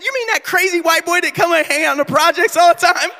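high, loud mock mom voice; friend's mother recalling him, teasing and amused. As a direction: tease him like his old mama. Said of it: "She said".

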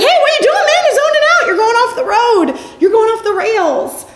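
high, urgent mock call, slowing at the end; friend's wake-up call. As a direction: shake someone out of a daze. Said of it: "You need a friend sometimes to be like".

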